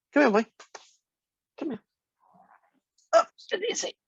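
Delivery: high-pitched pet talk; calling a pet. Real, not acted. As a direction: coax the dog up in short, high calls. Calling an animal over.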